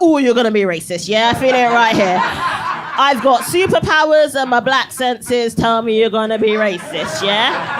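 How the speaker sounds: loud, teasing sass building to a slow proud declaration; mock sassy superhero. Comic telling the tour guide she senses racism, loud and sassy. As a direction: puff up like a superhero sensing trouble. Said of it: "I was like".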